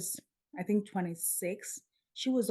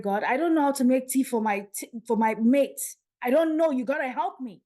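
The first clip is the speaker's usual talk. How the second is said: higher, louder, pleading prayer; tearful plea; 4 semitones higher, 7 dB louder than usual. Retold or imitated. Retelling a tearful prayer to God after the dream, raised and pleading. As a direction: beg God for help, voice rising toward tears. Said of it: "I woke up crying from that dream".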